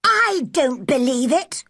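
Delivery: high-pitched, disagreeable disbelief. Acted. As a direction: refuse to believe him, disagreeably. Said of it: "in her most disagreeable voice".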